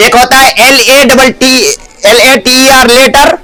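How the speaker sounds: ordinary delivery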